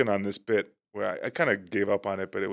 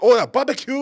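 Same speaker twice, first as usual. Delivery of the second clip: put-on high, loud Japanese accent; an excited, high Japanese tourist voice; 13 semitones higher, 8 dB louder than usual. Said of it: "interview the Japanese tourists".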